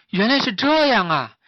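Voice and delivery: high, loud dawning understanding; sudden realization. Acted. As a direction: exclaim as it all clicks. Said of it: "小猪恍然大悟". (恍然大悟地感叹)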